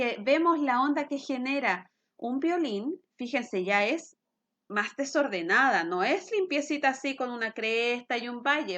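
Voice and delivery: ordinary talk, nothing marked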